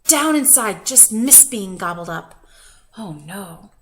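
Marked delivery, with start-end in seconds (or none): 3.0-3.7 breathy whisper. Real, not acted. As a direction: whisper it in relief. Reader hushed aside.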